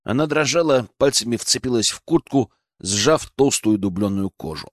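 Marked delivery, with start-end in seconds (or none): none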